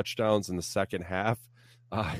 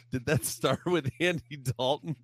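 ordinary talk

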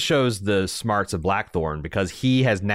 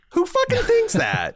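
high, stunned disbelief; incredulous. Real, not acted. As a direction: ask it in wide-eyed disbelief. Incredulous outburst.

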